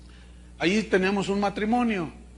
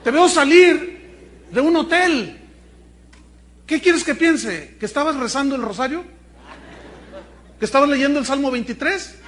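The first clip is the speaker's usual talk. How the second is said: angry wife; 6 semitones higher, 7 dB louder than usual. Acted. Wife confronting her cheating husband, loud and scornful. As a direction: tear into him, mocking his excuse.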